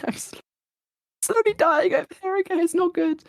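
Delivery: strained voice giving out; voice going. Real, not acted. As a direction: struggle through it as your voice fails. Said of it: "why my voice is going".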